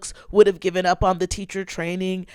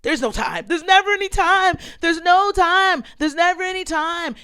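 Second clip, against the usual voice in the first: high, hammed-up panicky wail; TV meltdown quote; 9 semitones higher than usual. Quoting Saved by the Bell to followers, mock meltdown. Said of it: "If you're a fan of Saved by the Bell".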